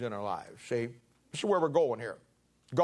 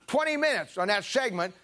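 usual speaking voice

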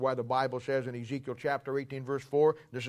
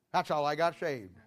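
usual speaking voice

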